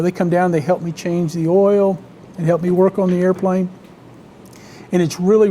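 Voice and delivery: usual speaking voice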